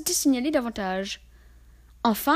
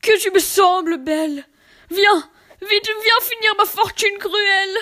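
high, loud pleading; pleading call; 8 semitones higher, 6 dB louder than usual. Acted. Wretched man begging death to come, loud pleading. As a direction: beg death to come quickly. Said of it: "lui disait-il".

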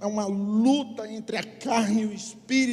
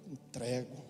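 very low, soft reply; subdued surrender; 8 semitones lower, 11 dB quieter, slower than usual. Retold or imitated. Believer yielding to God's demand, subdued and low. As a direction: give in quietly, voice sunk.

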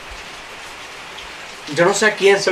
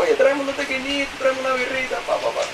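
high, softer party order; higher voice ordering tequila at a bar; ordering drinks; 7 semitones higher, 7 dB quieter than usual. Retold or imitated. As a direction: call to the bartender in a lighter higher voice. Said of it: "un día yo estaba".

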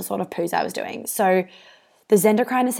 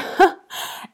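short airy giggle; a quick high giggle at herself; high breathy laugh; 11 semitones higher, 3 dB louder than usual. Real, not acted. Laughing at her own joke.

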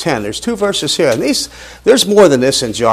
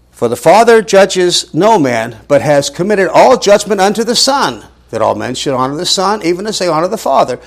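rising, slowing, louder reading; emphatic scripture reading; 6 semitones higher, 4 dB louder than usual. Acted. Preacher reading the verse to his class, building emphasis.

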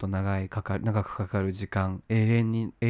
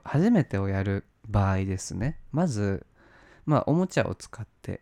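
ordinary talk, nothing marked

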